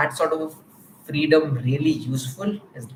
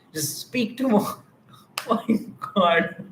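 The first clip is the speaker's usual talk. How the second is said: breaking into laughter mid-sentence, higher pitched; real laughing; 6 semitones higher than usual. Real, not acted. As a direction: crack up while trying to talk. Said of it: "why it is that I'm laughing".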